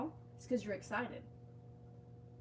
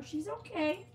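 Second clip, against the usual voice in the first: reassuring coo; 10 semitones higher, slower than usual. Real, not acted. Owner telling Faith her friend is fine, high gentle coo. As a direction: sing it up gently to the worried dog.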